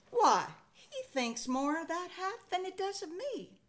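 a hint of a miffed wife; wife complaining about the hat, mildly huffy; Lil put out. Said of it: "the voice of Lil, his wife".

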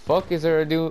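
ordinary delivery

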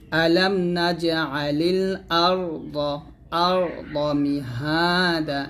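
slow chant of আর দ with a thick, full র; heavy ra demo. Shown as a demonstration. Teacher shows students the heavy ra, slowly chanted. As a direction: make the র in আর দ heavy and round. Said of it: "এটা মোটা করতে হবে".

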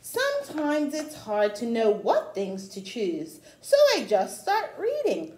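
rhythmic read-aloud of a rhyming picture book; storybook reading. Acted. Teacher reading a rhyming book to children, read-aloud style. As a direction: read the rhymes to children with a gentle lilt.

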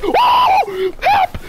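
high, loud cry for help; panicked shout. Real, not acted. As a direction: scream for help. Attacked while holding crown.

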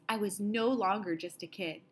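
plain reading voice

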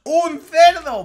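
high, drawn-out shout of amazement; grito de asombro. Acted. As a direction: yell it in exaggerated shock. Reacciona gritando a la mascota. (grito agudo y alargado de asombro)

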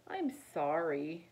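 ordinary delivery, nothing marked

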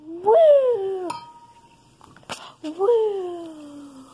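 long, high, loud "woo"; excited whoop. Real, not acted. Kid starting to dissolve the minis, excited whooping. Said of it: "I'm so excited to do this".

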